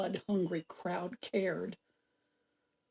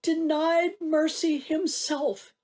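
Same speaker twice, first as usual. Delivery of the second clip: high, loud, grief-stricken tribute; slow down as the emotion swells; anguished pride; 5 semitones higher, 4 dB louder than usual. Acted. Mother mourns and admires her son.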